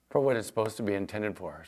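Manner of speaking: usual speaking voice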